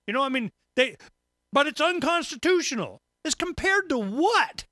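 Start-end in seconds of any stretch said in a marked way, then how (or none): none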